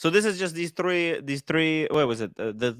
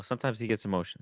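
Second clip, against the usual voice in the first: much softer and lower, half to himself; muttered repeat; 8 semitones lower, 13 dB quieter than usual. Real, not acted. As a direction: drop it under your breath. Repeats the excuse under his breath.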